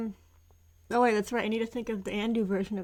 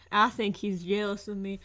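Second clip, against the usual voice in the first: ordinary delivery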